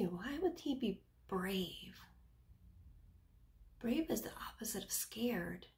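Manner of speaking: soft classroom whisper. Real, not acted. Teacher whispers to children about being brave.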